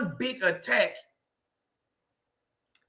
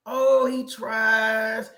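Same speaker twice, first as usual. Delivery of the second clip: knowing drawl; much slower than usual. Real, not acted. Drawn-out knowing repeat.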